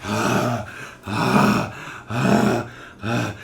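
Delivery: acted heavy panting; hard breathing. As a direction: gasp out hard breaths after torture. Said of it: "one of them's, like, breathing hard".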